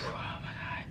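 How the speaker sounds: very soft, voiceless whisper; horrified whisper. Retold or imitated. Recalling a shot man throwing up blood, horrified whisper. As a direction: breathe it out, aghast at the memory.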